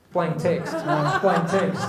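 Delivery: words breaking into a laugh; laughing punchline. Real, not acted. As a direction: repeat it through real laughter. Teller cracks up at own punchline.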